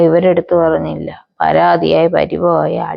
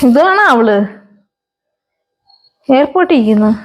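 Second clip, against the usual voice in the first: surprised; 6 semitones higher than usual. Acted. Mother sees photo, surprised.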